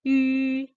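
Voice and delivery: "yu" held long, high and level; "yu" as a long unbending high tone; first tone. Shown as a demonstration. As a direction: stretch "yu" on one straight high note. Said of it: "panjang ya".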